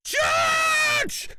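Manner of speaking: extremely high, drawn-out shriek; piercing old-woman screech; grandma's yell. A narrator's reading. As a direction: screech the name from afar. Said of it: "Grandma yelled".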